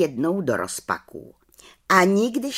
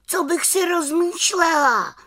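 little witch's indignant cry; 3 dB louder than usual. A narrator's reading. Little witch answering the aunt's doubt, indignant cry. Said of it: "vykřikla malá čarodějnice".

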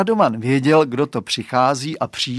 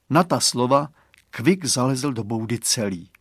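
ordinary delivery, nothing marked

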